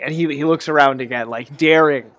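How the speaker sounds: usual speaking voice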